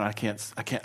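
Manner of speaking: ordinary talk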